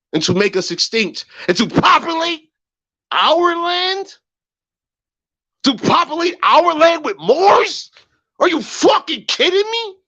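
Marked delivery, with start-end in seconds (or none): 1.5-10.0 much higher, strained, incredulous; outraged disbelief. Real, not acted. Streamer accusing conspirators of populating their land, incredulous outrage. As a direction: pitch leaps up in furious disbelief.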